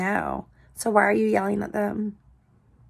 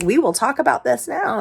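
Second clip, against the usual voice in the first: put-on insistent youngest child; 6 semitones higher, 5 dB louder than usual. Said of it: "He's like".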